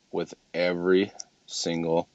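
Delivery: slow, deliberate and spaced out; slow for effect. Real, not acted. Speaker relishing a line about follow-up calls, slow for effect. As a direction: draw out each word for effect. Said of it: "I love to say it slow for effect".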